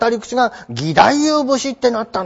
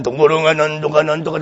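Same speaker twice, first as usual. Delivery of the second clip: lower, quicker joruri narration; lightly imitated joruri chanting; tayu's narrating voice; 7 semitones lower, faster than usual. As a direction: drop into the chanter's low storytelling voice. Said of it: "自分でこうやるわけですよ".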